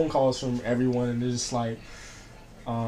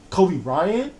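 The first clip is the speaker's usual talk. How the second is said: higher, steeply rising question; retold disbelief; 5 semitones higher than usual. Son retelling mom hearing of the death, incredulous question. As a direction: imitate a mother checking she heard right.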